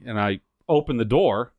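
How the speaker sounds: normal talking voice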